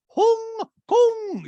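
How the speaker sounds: "Hong Kong" very high, both vowels stretched; Dorit. Retold or imitated. Podcaster mimicking Dorit saying "Hong Kong", squealed long vowels. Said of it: "She literally goes".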